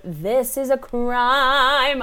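slow, drawn-out mock outrage; dramatic outrage. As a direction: stretch it out in mock horror. Reacting dramatically to character.

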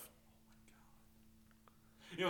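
slow breathy whisper of disbelief; exasperated whisper. Real, not acted. Mocking friend's rose socks, slow exasperated whisper. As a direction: sigh it out under your breath, slowly.

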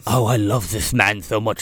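low voice with a heavy lisp; mock lisp. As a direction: lisp the s sounds like th. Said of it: "he's got a lisp".

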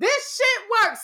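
high, slow, laughing delight; amazed. Real, not acted. Realizing manifesting got her first class, slow and delighted. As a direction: savor it, pitched high with a laugh.

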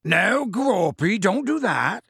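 slow, high-pitched scolding call; Hagrid shouting. Acted. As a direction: draw it out like warning a huge child. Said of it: "shouted Hagrid".

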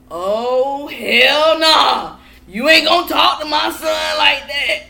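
loud, high shout of outrage, stretched out; guns blazing. Retold or imitated. Imitating aunt defending her son, guns blazing shout. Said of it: "She came in guns fucking blazing".

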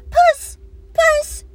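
high, loud call out a window; calling the cat. Acted. Neighbour calls kitten in from the window, loud high call. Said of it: "called a voice".

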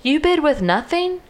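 high-pitched mock disbelief; acted incredulous protest. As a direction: retell his indignant disbelief, pitched well up. Acted outraged card player.

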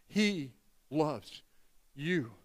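soft and low, with pauses between words; tender reassurance. Real, not acted. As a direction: bring it down gently. Soft close after preaching climax.

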